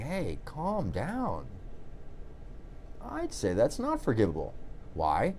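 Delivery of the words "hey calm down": much softer, slow "Hey, calm down"; gentle calm down. Retold or imitated. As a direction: ease it out slow and soft, like talking someone down. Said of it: "somebody saying".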